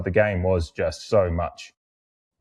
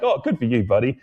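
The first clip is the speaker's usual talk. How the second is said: gleeful taunt; 5 semitones higher than usual. Retold or imitated. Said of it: "kinda just like".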